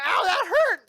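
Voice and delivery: high, loud mock wail of pain; hammed-up crying ouch. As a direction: cry out like a hurt little sister. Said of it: "And she's like".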